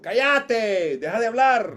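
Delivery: put-on loud, high parental yell to shut a child up; shouting "shut up" at a child; angry parent shout. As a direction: bark it like a furious father silencing his kid. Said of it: "los papás siempre le han dicho".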